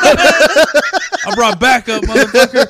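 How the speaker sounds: very loud and slow, cracking up; hammed-up tough kid line, laughing; laughing mock threat. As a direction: bellow it slowly through laughter. Acting out the kid's comeback.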